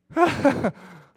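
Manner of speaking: big high-pitched laugh; loud high burst of laughter. Real, not acted. Laughing at himself.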